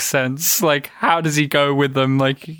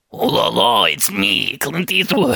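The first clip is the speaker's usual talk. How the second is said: mock French accent as Clint Eastwood; 3 semitones higher than usual. Co-host jokingly imagines Clint Eastwood in a hammed-up French accent. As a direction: put on a silly French accent, laughing through it. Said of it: "Has he dared to do a French accent".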